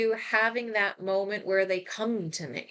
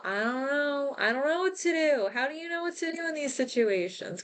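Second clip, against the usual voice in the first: higher-pitched, quicker, at a loss; helpless; 6 semitones higher, faster than usual. Retold or imitated. Grandchild keeping vigil for dying grandma, helpless. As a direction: let the pitch climb as the words speed up helplessly.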